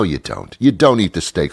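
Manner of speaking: normal talking voice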